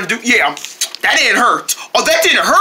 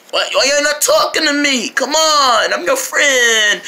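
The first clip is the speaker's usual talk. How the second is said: higher, drawn-out hurt plea; pleading whine; 5 semitones higher, slower than usual. Acted. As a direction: whine at friends who are snubbing you. Jerry pleads after being snubbed.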